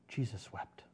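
softly repeated, almost breathed; hushed repeat. Shown as a demonstration. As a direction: let it fall away quietly.

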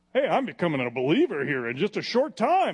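high, loud, incredulous voice; mock amazed king. Agrippa marvels at his quick conversion, loud and high. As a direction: play him stunned he's being converted. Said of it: "It looks like he's saying".

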